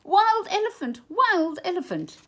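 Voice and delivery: high panicked screams; high frantic yelling in alarm; screaming. A narrator's reading. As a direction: scream it as fleeing crowd. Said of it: "began to run away screaming".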